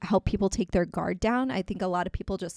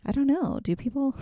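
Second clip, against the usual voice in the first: mock-innocent, pitched-up question; sarcastic; 7 semitones higher than usual. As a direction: feign puzzlement to poke fun. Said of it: "that's my sarcastic comment there".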